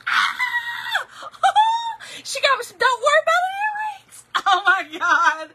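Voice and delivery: high, loud squeal of real delight; overjoyed scream. Real, not acted. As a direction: shriek with joy at a surprise gift. Screams on opening the gift earrings.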